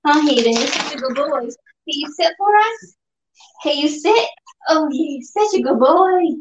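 talking to a dog. Real, not acted.

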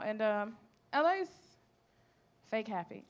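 normal talking voice

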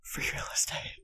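close-mic ASMR whisper; right in your ear. Pretend. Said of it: "really close to the mic, whispering".

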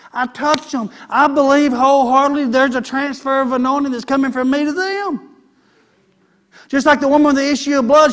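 full-force preaching, rhythmic and driving. Acted. Pastor preaching to the church, full force. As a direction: preach it out, riding the repetitions.

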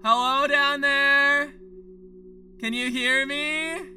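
high, slow drawn-out call; shouting across a field. Acted. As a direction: call out long and loud to someone far below. Calling down to a planet.

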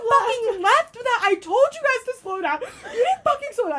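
very high, loud furious reenactment; retold bawling out. Girl bawling out the friend who left her, retold angry yell. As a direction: yell the accusation in mock outrage. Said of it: "I'm bawling her out".

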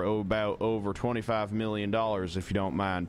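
put-on polite Southern accent, deadpan; country Southern accent. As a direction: play a courteous Southern country fellow. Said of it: "I'm stuck in this accent now".

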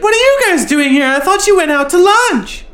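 very high, bright surprised voice; high-pitched Julie voice. Retold or imitated. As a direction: squeak it out like a surprised woman. Quote of Julie.

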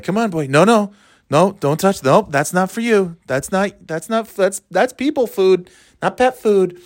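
imagined bike owner. Pretend. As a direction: correct a dog in a put-on high voice. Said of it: "walking the big tire bike around like".

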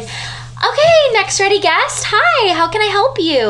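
customer service voice. Shown as a demonstration. Sister demonstrating her customer service voice, high and sing-song. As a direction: chirp it like a cashier calling the next guest. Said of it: "my customer service voice is like".

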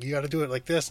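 a touch of character in the quote; lightly voiced film character. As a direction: lightly act out showing someone how. Retells German instructing flautist.

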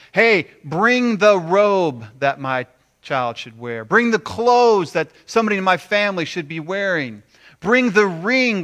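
loud, high calling out; jubilant command. Acted. Father orders gifts for returned son, loud and jubilant. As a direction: call out orders with rising excitement.

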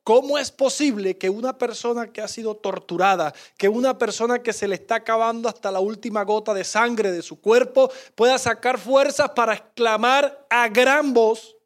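usual speaking voice

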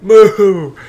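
loud, high, slow shouting; frantic yell. Retold or imitated. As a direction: bellow at the kid to move. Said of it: "And he's just like".

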